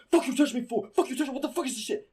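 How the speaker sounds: high, loud mock outburst; high-pitched retold flare-up; imitated squabble. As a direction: act out kids going off at each other. Kids squabbling on bus, retold.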